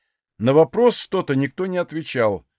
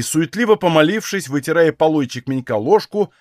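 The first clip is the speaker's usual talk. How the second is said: plain reading voice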